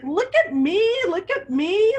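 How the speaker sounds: high, loud happy calls; excited cry. A narrator's reading. Teacher voices the penguin crying out to be seen, high and excited. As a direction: show off with glee. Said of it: "little penguin cried".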